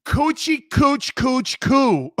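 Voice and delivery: "Coochie cooch cooch coo" very slow and loud, syllables pulled apart; sounding it out slowly. Shown as a demonstration. Host asks co-hosts if he's close, slow, loud. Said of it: "Am I close?".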